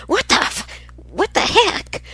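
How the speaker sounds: high squeaky shocked exclamation; startled. Retold or imitated. Grandmother reliving her shock at the talking TV, squeaky. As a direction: squeal it like you jumped out of your skin. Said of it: "we looked at each other like".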